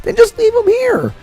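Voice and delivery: high-pitched exasperated protest; exasperated pleading. Retold or imitated. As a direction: argue it high, pleading the case to a friend. Acted argument with friend over food.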